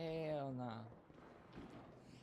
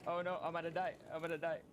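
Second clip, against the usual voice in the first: panicked; 6 semitones higher, faster than usual. Real, not acted. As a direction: yelp in real panic as you burn. Burning in game, panicking.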